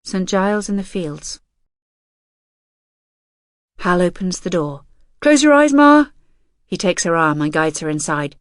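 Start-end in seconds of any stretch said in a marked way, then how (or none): 5.2-6.1 loud, high excited request; can't wait to show her; eager call. A narrator's reading. Hal leading his mother in, excited.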